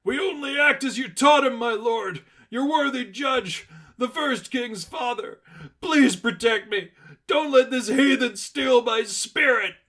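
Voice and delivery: high, loud desperate pleading cry; a panicked zealot begging his lord to save him; terrified scream. Acted. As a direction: scream it up to the heavens in terror. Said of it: "looked upward and screamed".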